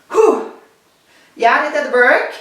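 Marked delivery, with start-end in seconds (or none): 0.1-0.5 a loud, higher puff of relief; relieved whew. Real, not acted. Teacher after a long count, a loud relieved whew. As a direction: blow out a breath of relief after the long chant. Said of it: "I'm getting out of breath".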